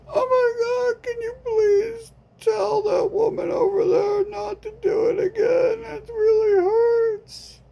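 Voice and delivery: hammed-up sobbing through the pain; mock sobbing wail. Victim begging friend to stop the woman, fake sobbing.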